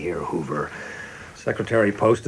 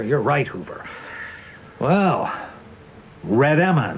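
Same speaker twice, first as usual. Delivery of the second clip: ordinary delivery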